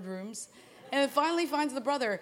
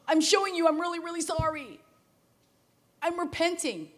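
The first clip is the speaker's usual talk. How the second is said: pleading apology; 7 semitones higher, 3 dB louder than usual. Retold or imitated. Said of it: "To say".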